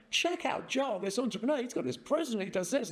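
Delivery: high, gushing show-off voice; excited wife. Retold or imitated. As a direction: brag about the guest to everyone. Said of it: "His wife's going".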